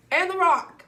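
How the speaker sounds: high loud punchline; loud emphatic. Real, not acted. Teen finding the rock in the park, exclaiming.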